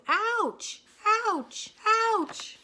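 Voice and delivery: high loud pained yelps; yelping. A narrator's reading. Cow yelping as chickens peck. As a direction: yelp at every peck.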